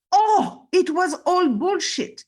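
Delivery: loud, high exclamation of realisation; sudden revelation. Retold or imitated. Storyteller voicing a dying woman's last words, loud sudden realisation. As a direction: burst out as if it just dawned on you.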